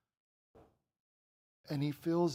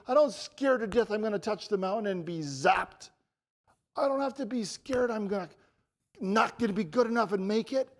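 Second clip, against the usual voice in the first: loud, higher, impassioned proclamation; full-force preaching; 6 semitones higher, 9 dB louder than usual. Real, not acted. Preacher proclaiming freedom from fear through Jesus, impassioned.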